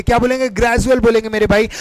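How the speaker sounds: normal talking voice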